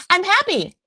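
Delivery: high, sing-song fake cheer; mock the mother's fake-happy voice. Imitating Molly pretending to be happy for her son. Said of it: "And Molly's like".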